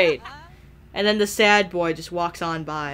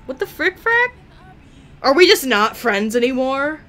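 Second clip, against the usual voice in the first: indignant squeal; 7 semitones higher, 6 dB louder than usual. Real, not acted. Indignant at being ignored.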